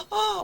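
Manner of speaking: high-pitched mock baby wail "아"; imitated baby cry. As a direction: imitate a sleepy baby crying "아". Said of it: "뭐 이런 식으로 울겠죠". (아기 울음소리 흉내)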